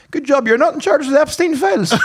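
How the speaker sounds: high-pitched teasing jab with a laugh; retold cheeky comeback. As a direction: tease in a high voice, half laughing.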